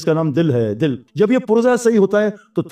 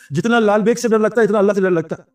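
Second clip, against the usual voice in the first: ordinary talk, nothing marked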